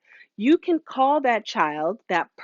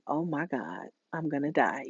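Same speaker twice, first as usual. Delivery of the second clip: much softer, frightened little voice; scared kid; 6 semitones lower, 10 dB quieter than usual. Retold or imitated. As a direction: murmur it like a caught child. Sister caught stealing gum.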